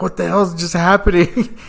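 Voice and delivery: startled awake and lost; groggy confusion. Retold or imitated. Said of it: "like, rolls over".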